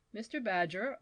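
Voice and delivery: ordinary delivery, nothing marked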